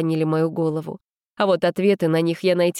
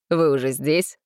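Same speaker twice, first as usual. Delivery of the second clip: indifferent; 3 semitones lower than usual. A narrator's reading. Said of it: "равнодушно сказал Женя".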